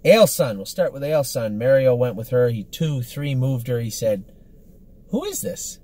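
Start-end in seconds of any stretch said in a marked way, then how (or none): 5.1-5.8 high-pitched surprised question; imitating an astonished reaction; quoted amazement. Quoting a driver's amazed reaction.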